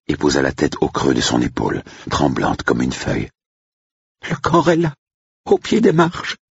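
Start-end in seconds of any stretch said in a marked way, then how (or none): 4.3-6.4 high, pleading, terrified voice; frightened cook. Acted. Cook begging Andrew to cover the body, high terrified plea. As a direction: beg him in a high frightened voice.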